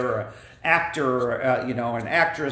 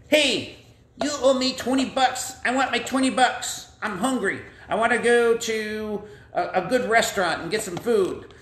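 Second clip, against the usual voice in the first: higher, pushy puppet voice; demanding creditor; 7 semitones higher than usual. Acted. Forgiven man demands twenty bucks from his friend, higher pushy voice. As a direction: press the friend for his money.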